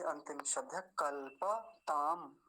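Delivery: ordinary delivery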